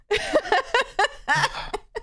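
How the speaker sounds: high rising laugh. Real, not acted. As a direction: giggle upward. Labubu joke lands.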